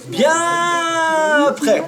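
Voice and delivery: very high, loud, long stretched cry; drawn-out exclamation. Real, not acted. Storyteller tells audience it was much later, drawn-out shout. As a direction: stretch it out at full voice.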